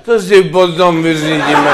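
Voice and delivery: put-on loud, slow drunk reply; podnapilý šéf. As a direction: answer slowly and loudly, as drunk as the colleague. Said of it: "ten šéf mu na to odpověděl". (hlasitá, táhlá odpověď opilého šéfa)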